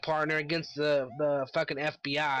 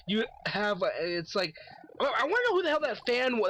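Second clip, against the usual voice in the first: ordinary talk, nothing marked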